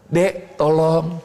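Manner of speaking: slow, gentle asking, not ordering; gentle request. Shown as a demonstration. Teacher shows how to ask a younger sibling gently. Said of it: "Jangan memerintah".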